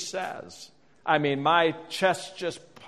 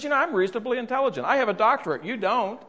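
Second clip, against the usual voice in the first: higher, quick, indignant wife; imitated wife going off; 7 semitones higher than usual. Wife tells husband she can solve her own problems, mock indignation. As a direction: play the wife getting worked up. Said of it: "then she really gets going".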